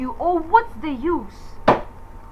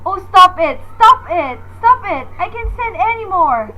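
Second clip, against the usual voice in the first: shriek, at the end of her nerves; screaming; 4 semitones higher, 8 dB louder than usual. Acted. Frightened woman screams at the ringing phone, at breaking point. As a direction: stop it screamed high and loud.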